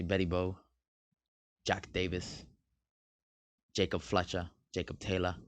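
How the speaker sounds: understated fake British accent; a hint of put-on British. Shown as a demonstration. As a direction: read the names in a lightly British accent. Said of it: "I say it in a British accent".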